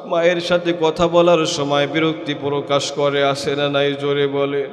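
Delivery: ordinary talk, nothing marked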